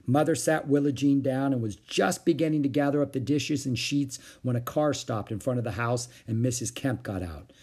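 usual speaking voice

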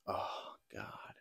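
breathy whispered "oh God", barely audible; whispered dismay. Retold or imitated. Young man sees his crush kissing another dude, whispering in dismay. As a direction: whisper it like your heart just sank. Retold quote, finding her cheating.

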